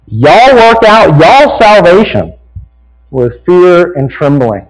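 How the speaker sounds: slow, drawn-out Southern drawl on y'all, lifted high; a drawling Southern preacher reading scripture. Shown as a demonstration. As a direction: read it with a thick Southern drawl, slowly. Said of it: "let me read it properly".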